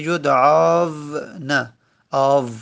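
یدعاون drawn out as yud-'aav-na, then آو alone; slowly expose the "aav" cluster in یدعاون; two silent letters. Shown as a demonstration. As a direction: hold the long "aav" in یدعاون and isolate it as آو. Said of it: "آو، الف ساکنه".

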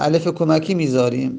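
usual speaking voice

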